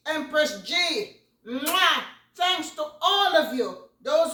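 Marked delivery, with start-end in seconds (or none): 1.5-2.0 blown kiss. Real, not acted. Blows kiss to subscribers.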